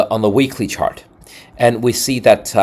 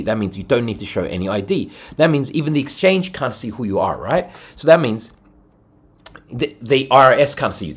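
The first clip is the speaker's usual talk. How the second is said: normal talking voice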